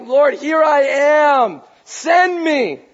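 high, loud, drawn-out plea; worshipful crying out. Acted. Isaiah bowed down before the Lord, crying out loudly. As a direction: call out slowly to heaven with full force. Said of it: "he's crying and he's worshiping the Lord".

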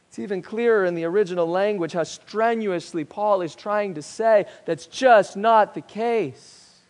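ordinary delivery

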